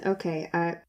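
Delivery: lightly voiced resigned guard; resigned sigh. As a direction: give in reluctantly. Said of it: "he kinda sighs".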